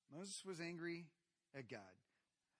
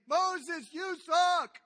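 much higher, loud jeering yell at Moses; angry crowd shout; 13 semitones higher, 18 dB louder, much slower than usual. Retold or imitated. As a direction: hurl it like an angry mob. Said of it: "They're wailing and angry".

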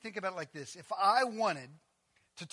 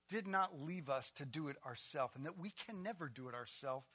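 ordinary talk, nothing marked

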